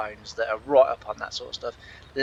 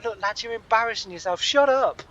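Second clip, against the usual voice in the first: exasperated telling-off; 8 semitones higher than usual. Retold or imitated. Bystander imagines telling two lads to stop, mock exasperated. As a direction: build to a sudden exasperated burst.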